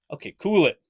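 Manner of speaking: put-on stern dad calming the kids down. Shown as a demonstration. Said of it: "That's my dad voice there for you".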